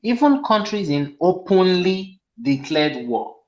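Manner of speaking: slow quote with "openly" drawn out; stress on openly. Real, not acted. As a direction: read it slowly, leaning hard on "openly". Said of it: "I emphasize on the word openly".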